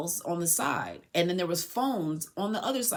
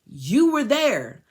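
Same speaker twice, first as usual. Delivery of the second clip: imitating angry cop; 4 semitones higher, 6 dB louder, slower than usual. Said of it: "He said".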